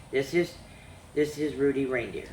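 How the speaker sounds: ordinary delivery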